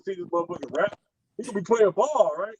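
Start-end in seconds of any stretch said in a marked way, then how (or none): none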